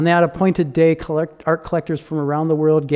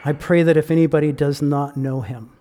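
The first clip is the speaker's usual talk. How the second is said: prayer. Real, not acted. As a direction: pray aloud gently for the listeners. Preacher leads closing prayer.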